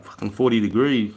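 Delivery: ordinary talk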